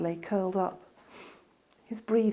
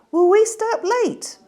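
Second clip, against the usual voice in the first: put-on high, loud, eager child asking; Petey begs his sister about bedtime, high child voice; little boy voice; 14 semitones higher, 10 dB louder than usual. Little brother Petey asks.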